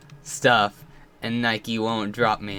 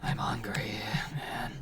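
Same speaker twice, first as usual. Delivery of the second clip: breathy hush, barely voiced; whispered aside; 3 semitones lower, 12 dB quieter than usual. Real, not acted. Whispering to viewers that he is hungry. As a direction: murmur it like a secret.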